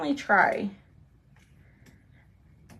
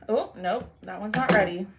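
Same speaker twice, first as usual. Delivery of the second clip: ordinary talk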